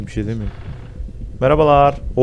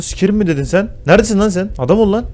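angry challenge; 7 semitones higher than usual. Real, not acted. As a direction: call him out angrily. Angry at insulting enemy.

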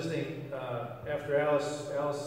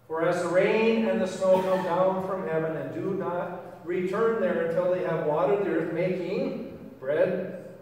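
measured, louder reading of scripture; 4 dB louder than usual. Acted. Pastor reads Isaiah's promise to the congregation, solemnly. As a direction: proclaim the verse with weight.

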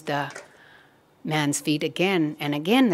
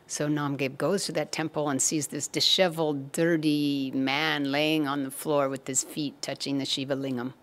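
usual speaking voice

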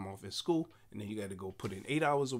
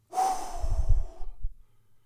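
loud, high exasperated sigh; huge sigh; 11 dB louder than usual. Real, not acted. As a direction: let out a big exhausted sigh. Exhausted parent looking at mother.

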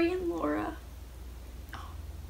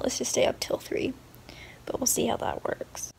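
whispering; 7 dB quieter than usual. Real, not acted. Whispering at bedtime.

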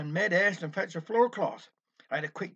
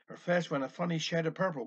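plain reading voice

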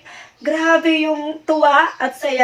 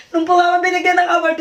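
ordinary talk

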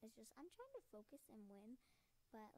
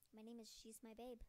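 ordinary delivery

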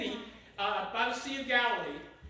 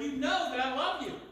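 higher, quick-answering affirmation; emphatic assurance; 4 semitones higher, 4 dB louder than usual. Acted. Peter answering Jesus the second time, emphatic. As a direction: assure him readily and firmly.

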